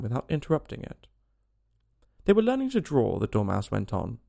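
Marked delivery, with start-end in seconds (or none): 2.2-3.2 high-pitched sleepy yawn-talk. A narrator's reading. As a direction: talk as if through a mild yawn. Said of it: "yawning and rubbing its eyes".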